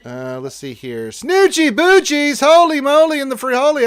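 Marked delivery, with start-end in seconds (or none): 1.1-3.8 very high, loud squawk; over-the-top comic shout; hyped outburst. Pretend. Hyped exclamation at a chatter.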